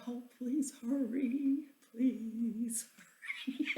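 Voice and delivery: slow, pleading plea. Retold or imitated. Wife holding the leak begging husband to hurry, slow plea. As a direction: beg him to hurry, drawn out.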